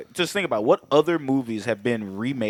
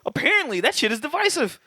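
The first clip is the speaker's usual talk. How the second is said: high mocking voice; 14 semitones higher, faster than usual. High-pitched mock outrage.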